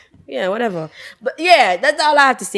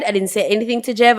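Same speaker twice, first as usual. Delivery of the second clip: normal talking voice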